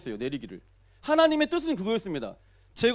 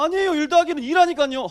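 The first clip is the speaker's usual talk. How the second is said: raised pitch, loud protest; exasperated insistence; 5 semitones higher, 8 dB louder than usual. Retold or imitated. Preacher acting out his argument with the youth, exasperated. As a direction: protest loudly, higher and insistent. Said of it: "제가 뭐라고 말했어요".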